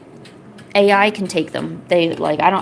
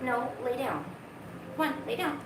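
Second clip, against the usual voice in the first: higher and softer, sing-song coaxing; coaxing pet talk; 7 semitones higher, 14 dB quieter, slower than usual. Real, not acted. Streamer coaxing the cat to lie down, softer and higher. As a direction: sweet-talk the cat into lying down.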